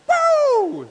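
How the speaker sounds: loud, high, long woo; excited whoop. Acted. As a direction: let out a piercing cheer. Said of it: "He's excited".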